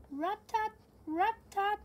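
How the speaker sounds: high loud rat-tat; woodpecker knock. Acted. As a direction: tap it out brightly. Woodpecker sound second time.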